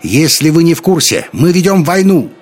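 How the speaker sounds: loud, high retort; yelling back. A narrator's reading. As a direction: shout down the heckler. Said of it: "орала в ответ Шарлотта".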